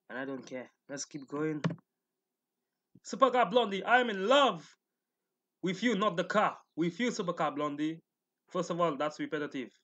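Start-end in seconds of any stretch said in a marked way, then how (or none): 3.0-8.0 mock lovesick fan. Reading a lovestruck fan comment.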